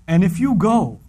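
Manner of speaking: ordinary talk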